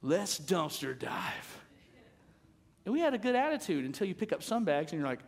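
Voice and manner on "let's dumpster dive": slow cheerful resolve. Retold or imitated. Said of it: "So I said".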